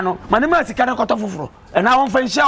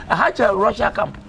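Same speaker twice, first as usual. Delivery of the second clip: usual speaking voice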